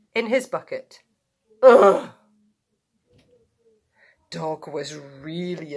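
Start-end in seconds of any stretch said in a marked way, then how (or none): 1.6-2.1 loud sudden grunt. Acted. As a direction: grunt as he flops down. Pig falls in bucket.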